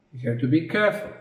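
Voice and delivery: ordinary talk, nothing marked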